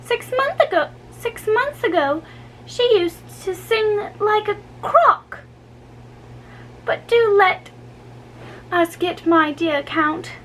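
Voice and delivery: put-on high, bright-pitched pert young girl voice; a cheeky curtsying dancer teasing; saucy girl. A narrator's reading. Young dancer teasing the Count, in a high saucy voice. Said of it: "the brat with a saucy curtsy".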